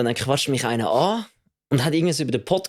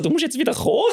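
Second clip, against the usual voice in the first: teasing friend; 11 semitones higher than usual. Retold or imitated. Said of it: "der Mike so".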